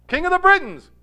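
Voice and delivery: high, loud kingly proclamation; insistent repetition. Retold or imitated. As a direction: play a king insisting on his title. Arthur repeats title, exasperated.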